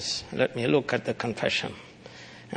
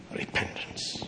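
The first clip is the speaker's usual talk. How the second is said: whispered answer; hushed whisper; 10 dB quieter than usual. Acted. Preacher answering his own question, whispered. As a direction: breathe the word as a secret.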